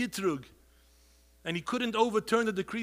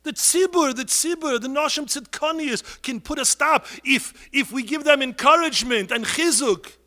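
fiery shout; 8 semitones higher, 15 dB louder than usual. Real, not acted. Preacher thundering at the crowd to act. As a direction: shout it out, high and loud.

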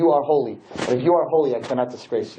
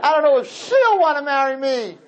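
laughing mock groom; 12 semitones higher than usual.

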